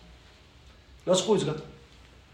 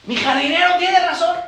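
higher, heated mock yell in the judge's voice; mock shouting; 12 semitones higher than usual. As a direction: shout "Mi jardinero tiene razón" like a judge losing himself. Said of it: "que está gritando". (grito exaltado fingido)

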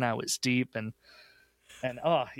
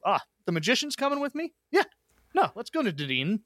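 excited high voice; 9 semitones higher, faster than usual. Retold or imitated. Excited teammate begging to ride along.

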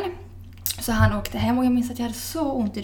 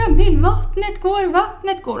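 high, loud, slow cry of alarm; retold scream; 9 semitones higher, 7 dB louder, slower than usual. Retelling how she screamed for Emil when her waters broke, high and loud. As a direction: shout for her husband as the waters break. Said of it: "jag skriker".

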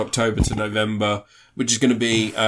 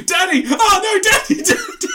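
high, loud mock wailing; hammed-up cries; 5 dB louder than usual. Volunteer backstage faking noises for the scene, hammed-up high cries. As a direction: cry out in exaggerated fake passion.

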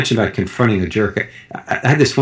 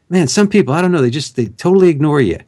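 pointed remark, higher and louder, meant to be overheard; loud complaint; 7 semitones higher than usual. Retold or imitated. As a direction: say it loud so the man walking past hears. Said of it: "in a loud voice, louder than before".

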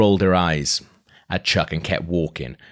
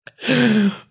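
much higher, a squeaky giggle; 3 dB louder than usual. Real, not acted. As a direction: giggle at the line. Reader laughs aside.

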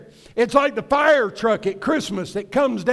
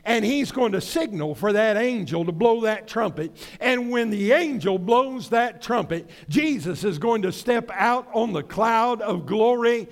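ordinary talk, nothing marked

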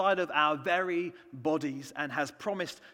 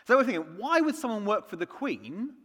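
ordinary talk

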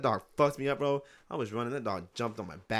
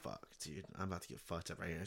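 soft, low, fast dread under the breath; hushed panic; 4 semitones lower, 12 dB quieter than usual. Retold or imitated. Boy alone facing a growling dog, hushed and fast. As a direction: mutter it fast as if the dog might hear. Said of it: "I'm like".

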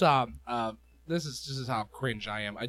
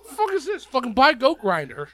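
high, loud mock outrage; hammed-up indignant squawk, pitched way up; Ron; 12 semitones higher, 7 dB louder than usual. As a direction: act out a man flipping out in disbelief. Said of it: "watch Ron be like".

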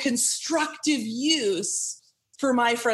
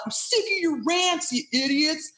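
mock angry rant; 6 semitones higher, slower than usual. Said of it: "I'm not like".

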